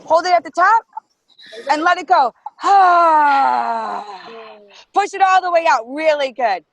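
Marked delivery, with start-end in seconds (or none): 2.4-4.4 big breathy sigh pushed out hard; loud exhale. Shown as a demonstration. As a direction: blow the stale air out with a loud ha.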